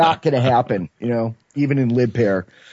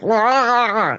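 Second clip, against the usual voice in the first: high, drawn-out wordless "rah"; high mock groan of exhaustion; groggy wail; 10 semitones higher than usual. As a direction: wail it like a sleep-deprived zombie. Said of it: "I was really just like".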